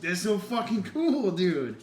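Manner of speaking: loud, high, drawn-out amazement; thrilled. Retold or imitated. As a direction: gush in awe, stretching it out. Retold thrilled reaction to players.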